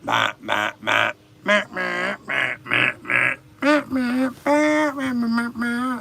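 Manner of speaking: silly bleating that rises to a squeak; sheep bleating. Pretend. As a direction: baa like a taunting sheep.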